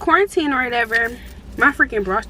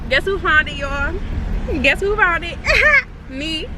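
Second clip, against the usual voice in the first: higher, gleeful boasting; proud of finding the way home; triumphant bragging; 6 semitones higher than usual. Real, not acted. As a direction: crow about finding it. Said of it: "call me G P S Miss".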